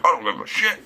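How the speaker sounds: high put-on voice, not caring; mock brazen guy. Tattooed sauna guy to the teens, brazen high mock voice.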